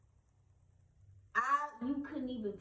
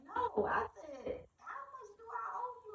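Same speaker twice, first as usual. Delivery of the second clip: high insisting; 12 semitones higher than usual. Retold or imitated.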